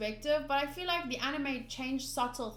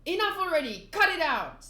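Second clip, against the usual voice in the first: loud, high yell; Nami screaming; 6 semitones higher, 7 dB louder than usual. Acted. Nami shouting down the clown, a loud high yell. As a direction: scream louder than him. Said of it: "Nami screams louder than him".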